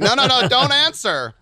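high loud panicked no no no. Retold or imitated. Telling himself not to answer the phone, high and panicky. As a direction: yelp it in panic. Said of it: "And I'm like".

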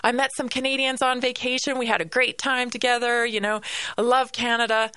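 high, excited gush that gradually settles; gushing fans. Retold or imitated. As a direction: imitate delighted fans of Canadians, starting very high. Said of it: "the response was always like".

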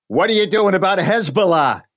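high, pushy Brooklyn woman; thick Brooklyn accent. Retold or imitated. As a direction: confront a stranger in thick Brooklyn. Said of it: "she's got this thick Brooklyn accent".

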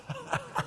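high, quiet burst of staccato laughter; imitated laughter. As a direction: act out an old man helpless with laughing. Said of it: "just laughing".

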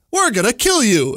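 lightly voiced sinister alien; creepy alien voice. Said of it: "it says in its creepy alien voice".

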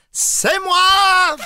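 high, loud party yell; surprise shout. Pretend. Friends hiding for a birthday, bursting out with a high shout. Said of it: "puis là, on fait".

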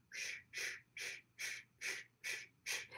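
rhythmic unvoiced shushing like a heartbeat. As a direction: shush in a steady pulse. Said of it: "the mother's heartbeat is going".

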